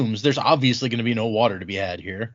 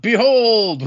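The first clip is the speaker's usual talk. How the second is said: loud, high booming Behold; mock proclamation; 10 semitones higher, 6 dB louder than usual. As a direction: announce it like a prophet on a wall. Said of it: "he screams out".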